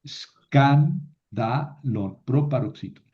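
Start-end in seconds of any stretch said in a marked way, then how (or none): 0.0-1.0 Escándalo broken into es-CAN, da, lo with pauses; syllable-by-syllable stress. Shown as a demonstration. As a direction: punch CAN in Escándalo, then separate each syllable. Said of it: "Es una palabra proparoxítona".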